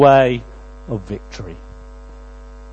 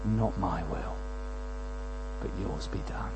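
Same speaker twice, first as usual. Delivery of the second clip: near-whispered, much softer surrender; hushed prayer; 9 semitones lower, 16 dB quieter than usual. Acted. Jesus yielding to the Father, near-whispered prayer. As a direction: breathe the words out as a prayer.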